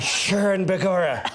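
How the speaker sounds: hammed-up cartoon Irish accent; Lucky Charms Irish. Shown as a demonstration. Actor mocking his wrong accent, hammy Lucky Charms Irish. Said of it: "evidently my accent's very Lucky Charms".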